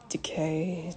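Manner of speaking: hushed whisper of decay. Retold or imitated. Whispering Decay to himself while he rots. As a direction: breathe the word Decay out like a dying man. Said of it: "just saying something".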